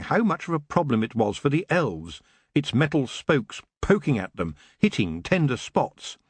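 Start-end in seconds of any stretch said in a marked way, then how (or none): none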